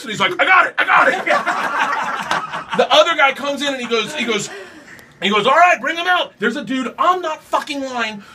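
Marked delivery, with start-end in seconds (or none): 0.4-2.6 high, loud, drawn-out voice; excited reply. Retold or imitated. Sound guy answers whether he's getting this, excited reply. 5.5-6.3 high, loud holler; calling out. Retold or imitated. The other guy calls for the horse, calling out.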